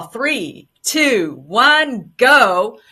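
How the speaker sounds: punchy countdown building to a loud GO. Real, not acted. Host launches her named countdown segment.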